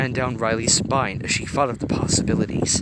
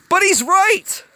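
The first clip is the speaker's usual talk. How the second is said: much higher, loud frantic protest; loud, far higher frantic argument; arguing; 10 dB louder, slower than usual. A narrator's reading. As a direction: insist he is right. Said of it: "Fear argued".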